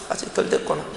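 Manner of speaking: breathy whisper to himself; hushed self-reminder; whispered inner voice. Retold or imitated. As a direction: whisper it like a thought. Inner voice repeated.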